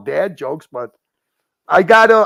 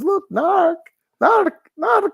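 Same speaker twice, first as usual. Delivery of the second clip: far higher, giggling mock Pakistani accent; giggly mock accent; slower than usual. Imitating a friend giggling at his lion, high mock accent. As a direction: squeal the name in a bad Pakistani accent. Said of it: "he goes".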